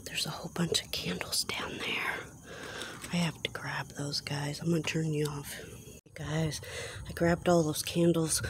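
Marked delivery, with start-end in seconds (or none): 0.1-4.6 whispering. Real, not acted. Whispering while dumpster diving.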